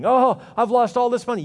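high-pitched mock-panicked investor. Preacher mimics someone in the market, in mock panic. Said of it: "you're panicking".

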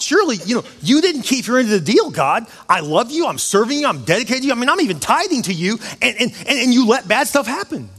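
high, pleading protest to God; indignant complaint. Acted. Believer complains to God about a broken deal, indignant complaint. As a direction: argue the broken deal, pitch leaping up.

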